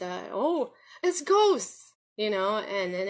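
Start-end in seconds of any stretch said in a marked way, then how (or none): 0.3-1.9 high, slow, hammed-up gasp of fright; mock spooky alarm. Recalling her childhood fear in the cemetery, mock spooky alarm. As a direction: drag it out like a kid spooked by ghosts. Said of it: "and what do I think it is?".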